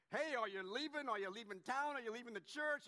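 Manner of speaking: mock-panicked neighbor, loud and high; hammed-up angst, pitched high and loud; anxious. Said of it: "all this anxiety and angst".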